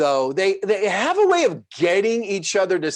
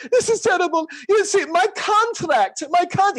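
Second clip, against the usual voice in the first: imitating George's whine; 13 semitones higher than usual. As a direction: play a sleepless man wailing in dismay. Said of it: "And he says".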